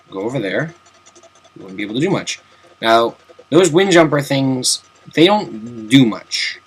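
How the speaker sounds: ordinary talk, nothing marked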